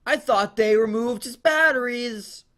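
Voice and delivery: high, slow whiny employee voice; a fed-up night worker grumbling; complaining employee. Acted.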